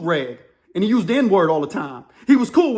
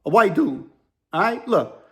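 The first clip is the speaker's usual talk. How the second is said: normal talking voice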